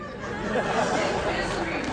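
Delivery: drawn out slow and loud; hammed-up misery; mock dramatic misery. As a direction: savour the word like a tragedy. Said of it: "You have to find what works for you".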